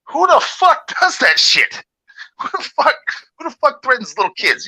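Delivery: retold disgusted outburst; outraged ranting. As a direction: drag it out in high-pitched disgust. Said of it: "I'm like".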